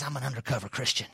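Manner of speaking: low, hushed, hurried punchline; secretive confession. Acted. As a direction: drop it right down like a furtive secret. Cartoon man confesses secretively.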